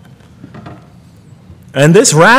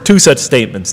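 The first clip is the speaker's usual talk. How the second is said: usual speaking voice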